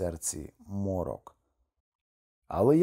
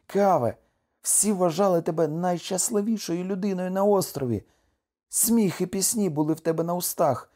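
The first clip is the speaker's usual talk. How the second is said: high-pitched, aggrieved wife pouring out her complaint; heartfelt high-voiced reproach; reproachful wife; 7 semitones higher, 3 dB louder than usual. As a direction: reproach your husband in a raised pitch.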